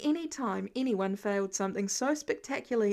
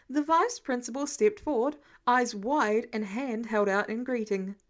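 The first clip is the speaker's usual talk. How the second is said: ordinary delivery, nothing marked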